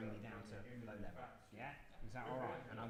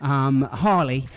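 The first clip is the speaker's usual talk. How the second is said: louder, upbeat show host intro; radio presenter; 2 semitones higher, 22 dB louder than usual. Acted. Presenter welcomes listeners to the live show, upbeat host voice.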